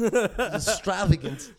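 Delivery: very slow, laughing Walken word; Walken impression. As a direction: stretch it out like Walken. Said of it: "great impression".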